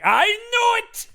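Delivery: high, drawn-out mock shout; hammed-up accusing cry; triumphant Van Helsing. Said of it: "Van Helsing is just like".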